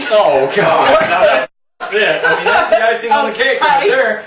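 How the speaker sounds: high, loud laughing outburst; oh God, laughing; laughing disbelief. Real, not acted. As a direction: crack up in disbelief. Disbelief at pipe-smoking woman.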